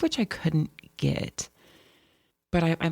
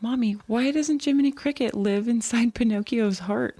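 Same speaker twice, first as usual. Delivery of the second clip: imitating her child; 7 semitones higher than usual. Mom retells her son's Pinocchio question in a childlike voice. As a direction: play the puzzled little boy asking Mommy. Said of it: "He's like".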